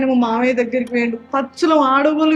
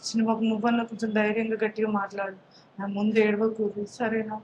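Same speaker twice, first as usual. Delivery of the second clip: much softer, gentle urging; soft pleading; 3 semitones lower, 9 dB quieter than usual. Acted. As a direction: drop the voice to a quiet plea. Quietly pleading with young son. (మెల్లగా బతిమాలడం)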